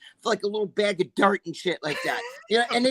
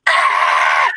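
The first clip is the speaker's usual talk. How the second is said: loud imitated scream; retold scream; 10 dB louder than usual. Girlfriend reacting to the pouch being opened, screaming. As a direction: imitate her startled scream, loud and sudden.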